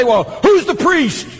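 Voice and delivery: skeptic's challenge. Retold or imitated. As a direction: play the doubter who demands an answer. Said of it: "And some people say".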